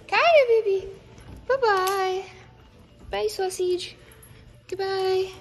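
high-pitched, sing-out farewell; cooing goodbye. Real, not acted. Saying goodbye to Kaya and sausage, high cooing voice. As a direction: coo it high, as to a pet.

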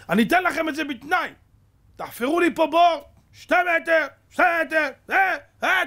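high, loud, insistent orders; shouted demand. Retold or imitated. Prisoner ordering partisans to dig for their food, shouted demand. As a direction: shout out the condition, pitched high.

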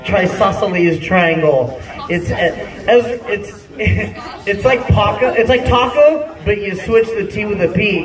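normal talking voice

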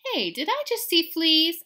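high, loud alarmed question; mama asking. Acted. Mama spotting fleas, high and loud. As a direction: ask it alarmed. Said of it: "asked Mama".

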